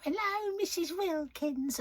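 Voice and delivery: put-on high, slow little old lady on the phone; old lady impression. Old lady ringing Michael's old workplace, high frail voice. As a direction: croon her name like a frail old caller. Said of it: "that's exactly how he said".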